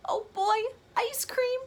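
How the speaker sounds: Gerald's thrilled squeal; excited. Acted. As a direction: burst with delight over ice cream. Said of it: "Gerald says".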